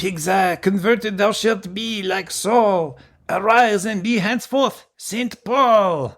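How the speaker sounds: high, slow mock French accent. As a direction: ham up a French priest's accent, slowly. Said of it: "Can you do it again in a French accent?".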